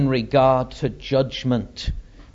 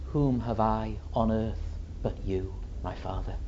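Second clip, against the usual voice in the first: quiet, lowered voice, dying to near silence; hushed devotion; 2 semitones lower, 9 dB quieter than usual. Acted. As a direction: murmur it submissively to a father. Suffering Son answers his Father.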